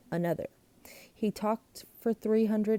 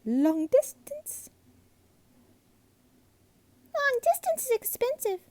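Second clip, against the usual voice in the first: alarmed cry; 3 dB louder than usual. A narrator's reading. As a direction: fret over the cost, rising. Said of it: "she cried".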